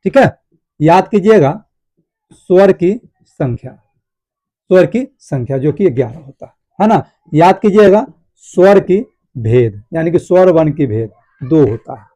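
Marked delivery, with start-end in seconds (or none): 2.3-3.8 slow, with a gap before the last word; slow dictation. Shown as a demonstration. Teacher dictating key points to students, slow and spaced out. 8.4-9.8 very slow, words set apart by pauses; slow dictation. Shown as a demonstration. Teacher dictating key points to students, slow and spaced out.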